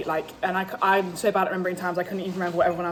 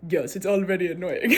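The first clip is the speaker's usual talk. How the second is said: put-on failed accent, slipping toward Russian; laughing, accidentally Russian-sounding accent; botched accent impression. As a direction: imitate his accent badly, laughing. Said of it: "I was like Russian. That wasn't his accent".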